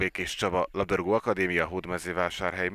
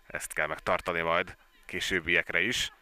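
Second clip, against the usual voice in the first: normal talking voice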